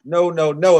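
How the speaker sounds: hammed-up Jamaican accent refusing a pricey plate; fake Jamaican accent. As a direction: tease out "no, no" in a mock Jamaican lilt, swelling at the end. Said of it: "in my fake Jamaican accent".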